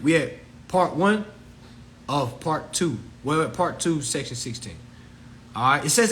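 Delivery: normal talking voice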